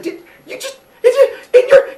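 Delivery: horrified mother who can't believe it; shocked shriek. Acted. Rhea shrieking at Cronus for swallowing the baby.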